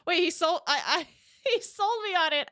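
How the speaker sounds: high, laughing as the words stumble out; amused disbelief. Real, not acted. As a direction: laugh at being completely fooled. Amused at being fooled by accent.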